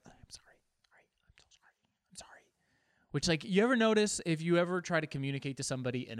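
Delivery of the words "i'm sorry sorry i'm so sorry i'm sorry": hushed, voiceless whisper; whispered apology. Retold or imitated. Driver apologizing to someone in another car, whispering. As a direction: whisper an apology nobody can hear. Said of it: "you whisper".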